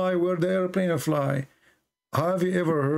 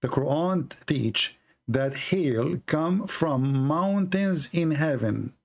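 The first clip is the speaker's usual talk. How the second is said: drawn out word by word; slow emphatic restatement; slower than usual. Real, not acted. Debater slowly repeating the verse to the guest, emphatic. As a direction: spell it out slowly so he can't dodge.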